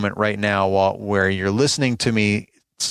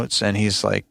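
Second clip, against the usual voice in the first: ordinary talk, nothing marked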